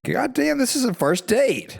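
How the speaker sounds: high-pitched exclaimed protest; mock astonishment. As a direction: blurt it out in disbelief, pitched high. Retelling an astonished reaction to marriage talk.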